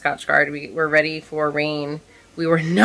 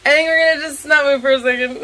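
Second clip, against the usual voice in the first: tense nervous exclamation; 8 semitones higher, 5 dB louder, faster than usual. Retold or imitated. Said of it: "it would be like".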